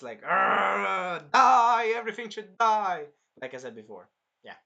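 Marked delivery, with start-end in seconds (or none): 0.2-3.1 imitated berserker roar, loud and high; berserk rage. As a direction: roar it like you've snapped. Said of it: "He was just red and nuts".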